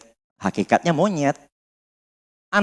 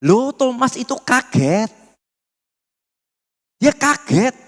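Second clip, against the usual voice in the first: high, slow, mock-indignant "Loh"; preacher mimicking the Jehovah's Witnesses' excuse, high and scoffing; mocking objector; 6 semitones higher than usual. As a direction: play the objector, scoffing and lifted. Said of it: "apa yang mereka katakan?".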